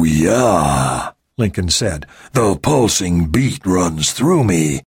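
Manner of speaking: low and slow, laid back; deep, slow soul man voice. Acted. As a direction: croon it like a soul singer. Lincoln character voice.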